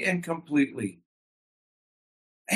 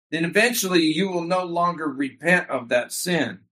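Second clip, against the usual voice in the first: usual speaking voice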